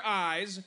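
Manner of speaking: "I's" standing for the letter A; Australian vowel shown. Shown as a demonstration. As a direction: say "I's", A turned into long I. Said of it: "your A's are I's".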